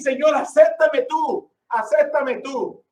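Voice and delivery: slow, earnest plea to the Lord; slow humble begging; slow pleading prayer. Shown as a demonstration. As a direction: draw out acéptame tú like a real prayer. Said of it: "Tú tienes que ir".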